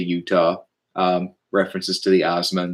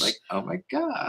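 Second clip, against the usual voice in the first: put-on much higher, softer Valley Girl gush; 8 dB quieter than usual. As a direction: squeal it like a ditzy Valley Girl. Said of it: "the Valley Girl voice".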